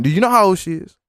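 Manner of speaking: Chris Hansen impression. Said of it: "Chris Hansen fucking coming in".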